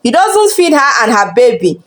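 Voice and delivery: usual speaking voice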